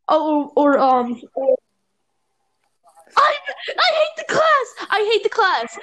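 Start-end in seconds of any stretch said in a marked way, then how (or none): 3.2-5.8 high, slow, laughing complaint; high laughing rant about a hated class. Real, not acted. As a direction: vent through laughter.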